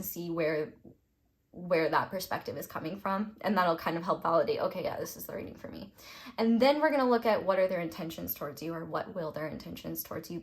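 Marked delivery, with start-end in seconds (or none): 4.6-5.9 quick whisper; whispered inner voice. Retold or imitated. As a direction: whisper it fast, like a thought. Voicing the viewer's inner thought.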